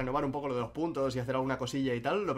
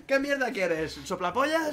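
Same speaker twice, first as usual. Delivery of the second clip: Vegeta insult; 8 semitones higher, slower than usual. Retold or imitated. Imagining an uncensored Vegeta line for the chat, mock shout. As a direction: bark the insult like a furious cartoon villain. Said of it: "que Vegeta le dije".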